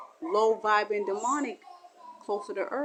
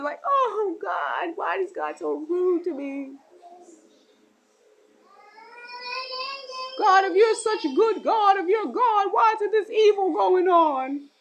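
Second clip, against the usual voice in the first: higher, louder, indignant questioning; angry complaint to God; 11 semitones higher, 5 dB louder than usual. Acted. A bitter believer accusing God, higher and louder. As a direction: demand answers from God.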